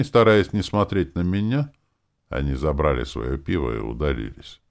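ordinary delivery, nothing marked

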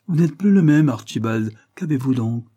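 high, loud, taken-aback cry; startled exclamation. A narrator's reading. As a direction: react as if stung by his tone. Sister startled by his tone.

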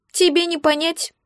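high, huffy mutter; sulky grumble. A narrator's reading. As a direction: grumble it like a sulking pet. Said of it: "обиженно буркнул Арч". (обиженное бурчание)

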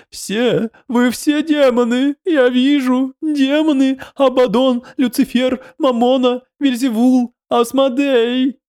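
high-pitched frantic shrieking; raving madman. Acted. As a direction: howl out demon names in terror. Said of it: "орал сумасшедший". (истеричный крик безумца)

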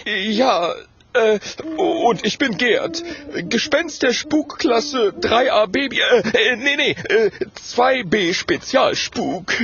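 high, faltering self-introduction; flustered little ghost, high-voiced; nervous stammer. A narrator's reading. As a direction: fumble through it, correcting yourself anxiously. Scared ghost haltingly introduces himself.

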